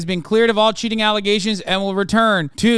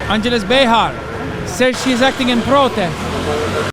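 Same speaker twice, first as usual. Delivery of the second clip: put-on Italian accent; 5 semitones higher than usual. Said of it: "so hard for me not to do an Italian accent".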